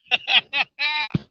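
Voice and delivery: real delighted laughter; gleeful laugh. Real, not acted. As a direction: run of happy ha-ha-ha. Laughs while fighting the fish.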